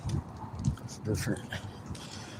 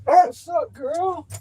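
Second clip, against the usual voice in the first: high sing-song hello; pet greeting; much slower than usual. Real, not acted. Greeting the dog in high baby talk. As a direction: squeak it to the dog.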